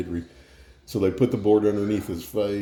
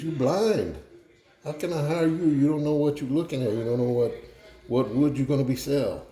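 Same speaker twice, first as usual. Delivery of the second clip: high-pitched Cajun joke voice; Justin Wilson; 7 semitones higher than usual. Acted. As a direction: protest incredulously in a Cajun accent. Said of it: "do the Justin Wilson thing and tell a joke".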